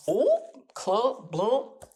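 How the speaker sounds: a playful spoken clunk noise; mock clunk sound effect. Telling viewers the moment lands, a playful mock sound effect. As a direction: voice a cartoon thud. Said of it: "whatever sound effect you can insert".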